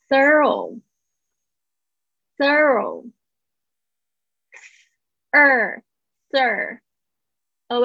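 very slow "thorough", broken into "thir" and "O"; syllable breakdown. Shown as a demonstration. Teacher dissects a tricky word, very slow "thorough". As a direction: stretch and separate each sound of "thorough". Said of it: "这个字要特别注意一下".

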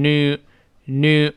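American “new” without a y, noo; American pronunciation. Shown as a demonstration. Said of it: "多数情况下去掉". (“new”美式读法，去掉“耶”音)

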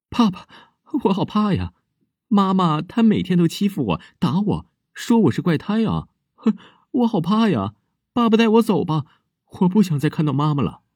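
put-on high, pleading little girl crying to her dead father; a scared child begging her father to take her away; little girl's voice. Girl pleads with her dead father, high childlike voice.

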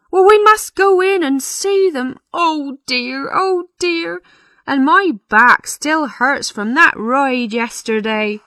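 high-pitched, fretting and complaining; flustered parson. Acted. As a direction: moan like a put-upon parson.

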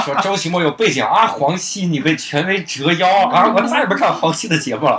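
half-laughing parody of angry fans; 边笑边学网友说话. Retold or imitated. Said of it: "然后说". (笑着模仿网友的愤慨)

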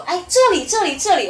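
high, loud call to the police. Retold or imitated. Neighbour calling the arriving police over, loud and high. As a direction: wave them over with your voice.

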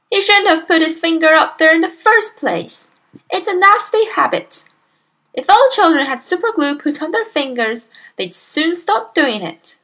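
high, loud, scolding voice; disapproving mother. A narrator's reading. Mother condemning nose picking, disapproving. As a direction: moralise about a nasty habit.

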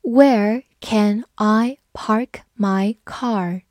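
very slow, spaced read-along. Shown as a demonstration. Teacher reads slowly for students to repeat. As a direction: stretch every word for the learners. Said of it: "我们慢读一遍".

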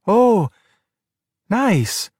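high, forced enthusiasm; boy politely feigning interest in his dad's crab; faking interest. A narrator's reading. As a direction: pretend you care about the crab. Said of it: "did his best to sound interested".

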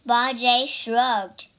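ordinary delivery

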